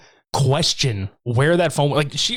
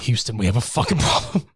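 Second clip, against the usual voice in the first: mock mission control. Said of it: "movie quote parody".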